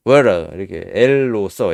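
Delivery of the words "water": "water" with T turned L-like; rolled American T. Shown as a demonstration. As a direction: roll the tongue on the T of "water". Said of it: "이 T 발음을 혀로 굴려가지고". ("water"의 T를 L처럼 굴려서)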